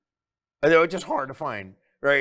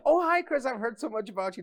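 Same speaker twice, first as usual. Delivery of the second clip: put-on much higher, gushing girlish voice; imitating the girl; 10 semitones higher than usual. Imitating her meeting the "twin brother", high and gushing. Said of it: "she was like".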